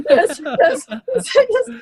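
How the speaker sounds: ordinary talk, nothing marked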